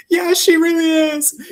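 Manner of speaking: high, choked-up agreement; retold teary reply. Cast member recalling sobbing backstage, high and teary. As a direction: answer through tears, pitched high. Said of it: "I was like".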